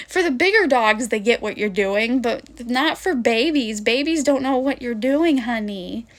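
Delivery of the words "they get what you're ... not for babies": usual speaking voice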